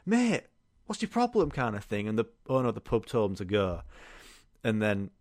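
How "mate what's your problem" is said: high, sharp retort; mock challenge. Mate confronting the drunk man, high mock challenge. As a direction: throw it back at him. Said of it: "was like, Mate".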